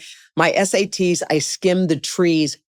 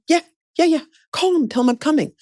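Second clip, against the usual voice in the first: high, tumbling eagerness; re-enacted keen, pitched-up agreement; eager retold yes; 11 semitones higher, much faster than usual. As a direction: jump in fast at the chance. Retold leaping at next chance.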